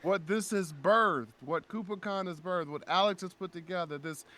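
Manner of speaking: high, fervent public address; impassioned stage speech. Retold or imitated. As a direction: declare it with feeling to a crowd.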